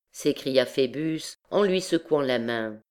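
ordinary delivery, nothing marked

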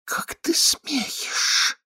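slow, hissed disbelief; drawn-out, voiceless indignation; outraged whisper. A narrator's reading. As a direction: whisper it slowly in fury. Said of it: "прошептала она".

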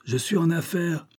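high-pitched call at ordinary volume; shouted through door. A narrator's reading. Boss fending off callers through the door, shouted. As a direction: call it out high to someone outside. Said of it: "cria-t-il à travers la porte".

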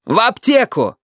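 high-pitched exasperated shout; acted grandson's furious cry; angry yell. A narrator's reading. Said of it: "заорал Юрик".

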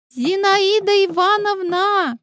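high, sing-song polite calling; polite young girl voice. Acted. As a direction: call through the door sweetly. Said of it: "раздался вежливый голос".

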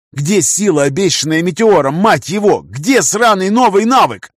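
rising exasperated rant; frustrated outburst. Acted. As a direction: vent the anger, building up. Frustrated outburst mid-battle.

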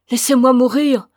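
grieving mother pushing her husband away; desperate cry. A narrator's reading. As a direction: cry it out in grief. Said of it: "disait-elle".

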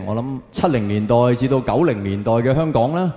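usual speaking voice

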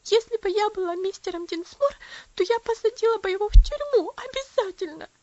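high loud sobbing outburst. A narrator's reading. Lucy sobs to her mother about Arthur, high and loud. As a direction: sob it out, indignant. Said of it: "всхлипывала она".

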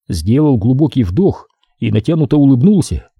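plain reading voice

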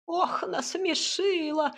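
put-on high, drawn-out clucking old hut; cackling hut. A narrator's reading. Living hut after a fit of laughter, clucking high and amused. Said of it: "кудахтала изба".

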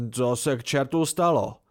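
urgent demand. Acted. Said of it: "vyptával se naléhavě".

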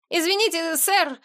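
put-on high, pleading boy voice; thin pitiful little voice. A narrator's reading. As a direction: babble it in a small, put-on pitiful pipe. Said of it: "пролепетал он специальным тонким голоском". (тонкий жалобный голосок)

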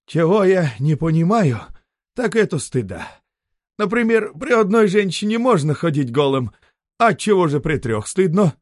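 merchant musing. A narrator's reading. Merchant philosophising.

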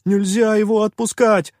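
high, loud, overwrought cry; trembling shout. A narrator's reading. Попрыгун objects to freeing pirate, trembling shout. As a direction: cry out as if losing control. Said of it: "дрожащим голосом выкрикнул попрыгун".

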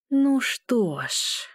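slow eerie singsong whisper; drawn-out menacing hush; creepy whisper. A narrator's reading. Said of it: "жуткий шёпот пугает".

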